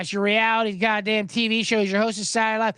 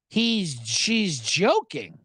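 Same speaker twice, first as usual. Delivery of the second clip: ordinary talk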